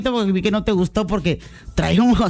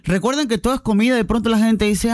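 usual speaking voice

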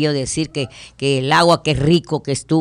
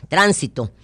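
ordinary talk

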